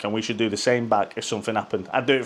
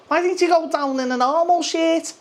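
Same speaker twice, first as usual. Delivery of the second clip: very high, whiny mock voice; mocking critics. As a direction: parrot the complainers in a silly high voice. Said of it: "'cause people said".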